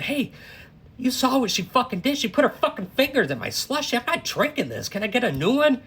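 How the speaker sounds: high, loud indignant demand; angry complaint. Retold or imitated. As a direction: demand a new one, still furious. Complaining angrily to the counter girl.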